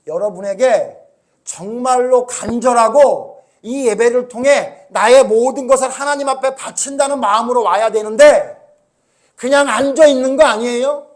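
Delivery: loud, high, urgent sermon; full-force preaching. Acted. Pastor telling how Jesus overturned the temple tables, full-force preaching. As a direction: drive it home to the whole congregation.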